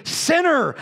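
high sharp cry of "Sinner!"; mock accusing yell. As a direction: fling the word like a judgment. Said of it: "I would yell at her".

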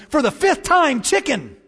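high-pitched, fed-up punchline; exasperated wife. Acted. Wife answers husband who keeps asking about dinner, mock exasperated.

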